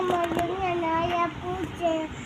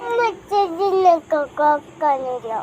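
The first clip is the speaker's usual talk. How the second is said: loud, teary complaint through sobs; crying complaint; 7 dB louder than usual. Real, not acted. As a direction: blurt the grievance out while still crying. Child explaining her crying.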